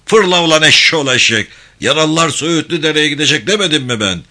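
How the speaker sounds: loud, fast furious tongue-lashing; angry scolding. Acted. The captain berates Abdullah for disobeying orders, shouting. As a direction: chew him out at full volume.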